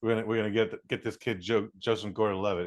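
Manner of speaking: ordinary talk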